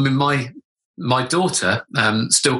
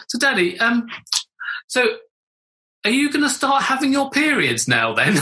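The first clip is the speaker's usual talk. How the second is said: put-on young daughter asking; 10 semitones higher than usual. Daughter asks question through door.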